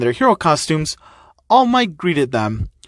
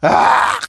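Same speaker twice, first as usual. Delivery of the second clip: frustrated yell; 6 semitones higher, 4 dB louder than usual. Real, not acted. Reacting to the puns.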